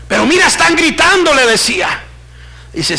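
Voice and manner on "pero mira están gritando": high, urgent exclamation; alarmed protest. Acted. Visionary telling the Lord they are screaming, alarmed. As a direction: push it out high in dismay.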